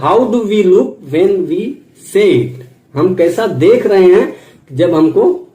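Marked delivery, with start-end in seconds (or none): 0.0-2.7 drawn-out, deliberate reading; slow quote. Shown as a demonstration. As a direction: read it slowly to the trainees. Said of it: "quote read slowly". (धीमा, सोच-समझकर पढ़ा गया उद्धरण)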